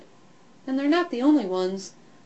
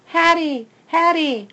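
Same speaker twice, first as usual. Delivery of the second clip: calling the name, higher and louder; 8 semitones higher, 8 dB louder than usual. A narrator's reading. Trapped puppy calling for his girl, loud. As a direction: call her as loud as you can. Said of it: "I bark as loud as I can".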